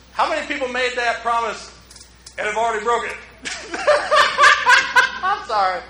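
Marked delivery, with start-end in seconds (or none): 3.2-5.2 big high laugh. Real, not acted. Laughs at broken promises.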